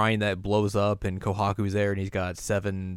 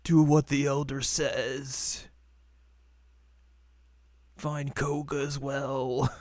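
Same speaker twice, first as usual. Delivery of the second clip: put-on old man voice; 5 semitones higher, slower than usual.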